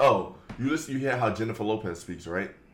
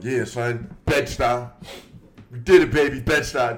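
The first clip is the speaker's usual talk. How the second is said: loud, hyped-up borough shout-out; mock hometown hype; 6 dB louder than usual. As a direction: rep the block loud, hammed-up.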